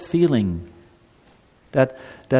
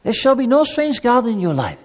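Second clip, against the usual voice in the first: raised, tender plea as a loving God; gentle divine invitation, pitched up; 5 semitones higher than usual. Shown as a demonstration. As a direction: coax the people like a caring father. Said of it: "That's not how God speaks".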